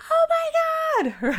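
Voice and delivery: very high, drawn-out mock squeal; freaking out. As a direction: squeal it slowly, acting out an inner freak-out. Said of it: "freaking out".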